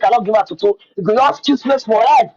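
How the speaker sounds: ordinary delivery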